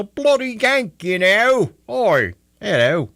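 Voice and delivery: a hammed-up cockney greeting; cockney accent. Host shows off his cockney on air, hammed-up cockney. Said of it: "That's my cockney".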